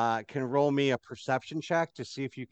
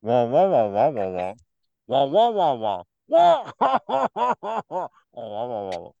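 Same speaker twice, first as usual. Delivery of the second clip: loud trombone-like wah wah; muffled voices; 6 dB louder, slower than usual. Shown as a demonstration. GM mimics muffled talk heard through door. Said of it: "all you heard is".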